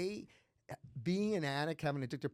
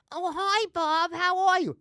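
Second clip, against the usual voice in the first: much higher and louder, chirpy aunt greeting; aunt on the phone; 12 dB louder than usual. Retold or imitated. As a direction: gush like a delighted aunt. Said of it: "she thinks she's talking to my father".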